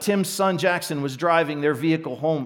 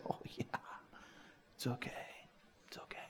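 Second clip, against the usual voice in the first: soft, near-silent comfort, voiceless and gentle; whispered reassurance; 5 semitones lower, 25 dB quieter, faster than usual. Retold or imitated. Pastor comforting a grieving father on the phone, whispered. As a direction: soothe him in a hush.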